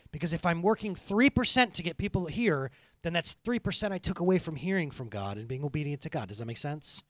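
ordinary delivery

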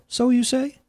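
high, rising doubtful query; skeptical questioning. A narrator's reading. Jesus echoes Death's claim, doubting it. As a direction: throw the boast back as a high question.

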